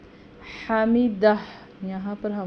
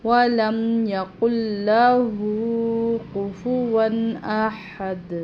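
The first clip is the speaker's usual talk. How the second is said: recited verse, a touch louder; Quran recitation; 2 semitones higher, 7 dB louder, slower than usual. Shown as a demonstration. Teacher reciting the verse for students, recited. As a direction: chant the last verse evenly.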